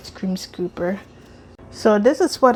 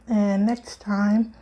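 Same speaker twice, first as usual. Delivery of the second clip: normal talking voice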